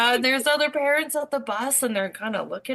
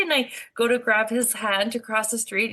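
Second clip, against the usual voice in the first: normal talking voice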